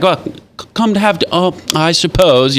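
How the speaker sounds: mock reluctant disciples. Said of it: "And they're like".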